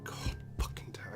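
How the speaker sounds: ordinary talk, nothing marked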